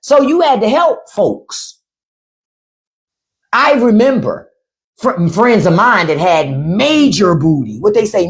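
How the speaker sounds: ordinary talk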